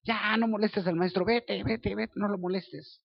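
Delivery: regaño imitado, en tono agudo; discípulos hartos. Retold or imitated. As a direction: imita a quien ahuyenta con impaciencia. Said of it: "Y los discípulos decían". (regaño fingido, agudo e insistente)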